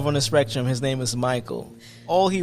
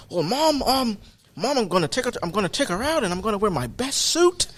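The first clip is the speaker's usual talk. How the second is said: higher, hesitant impression with Australian lilt; imitated earnest Australian son; 6 semitones higher than usual. Mike telling his mom about his date, imitated earnest voice. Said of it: "And he's like".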